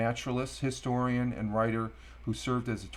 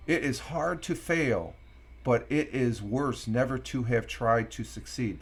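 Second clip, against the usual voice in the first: emphasized quote. Shown as a demonstration. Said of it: "And I'm emphasizing it".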